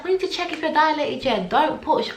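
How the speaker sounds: high, matter-of-fact midwife voice; mock midwife. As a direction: tell her firmly to wait. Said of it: "And she's like".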